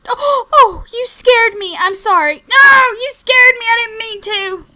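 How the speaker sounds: flustered after a scare; real panic; panicked shrill. Real, not acted. Panicked apology to villager.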